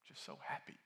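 imitating a hollow whispered cheer; mock whisper. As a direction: breathe it out like a forced brave face. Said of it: "tell me".

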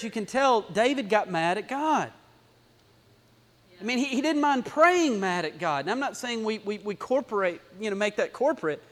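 normal talking voice

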